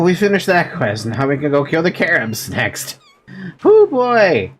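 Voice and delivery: normal talking voice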